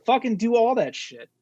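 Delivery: high, exasperated urging; fired up. Real, not acted. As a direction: demand it with worked-up enthusiasm. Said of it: "I get fired up".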